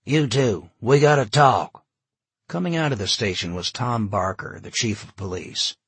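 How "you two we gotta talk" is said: loud, higher summons; calling out. A narrator's reading. Police chief calls two men over, calling out loudly. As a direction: summon them from across the way.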